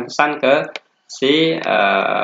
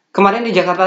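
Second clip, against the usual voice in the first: higher, run-on demo without a break; pitched-up example of missing pauses; 6 semitones higher, faster than usual. Shown as a demonstration. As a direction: run the phrase on without pausing. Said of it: "Terus ada intonasi jeda".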